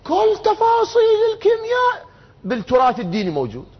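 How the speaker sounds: voicing maximalists at a high pitch; emphatic sweeping claim. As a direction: proclaim it high, as an absolute claim.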